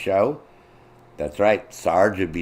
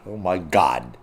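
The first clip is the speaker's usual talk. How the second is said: normal talking voice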